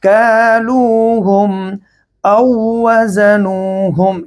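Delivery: slow, drawn-out melodic chanting; Quran recitation. Shown as a demonstration. Teacher recites the verse slowly for students. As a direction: recite each word slowly in tajweed melody.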